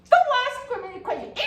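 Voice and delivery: high, fast angry snap; snapping in anger. Retold or imitated. Angry student snapping at the nosy roommate, high and fast. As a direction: bite their head off. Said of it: "You say".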